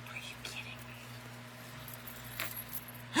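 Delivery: breathy whisper, almost no voice; whispered disbelief. Retold or imitated. To her husband in the store, a stunned whisper. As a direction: let the repeat die into a whisper.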